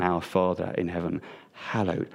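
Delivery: hushed, soft reverent breath; barely breathed. Shown as a demonstration. Preacher breathing the Lord's Prayer to the congregation, hushed. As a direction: breathe the holy name gently. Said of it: "Breathe that, just barely breathe it".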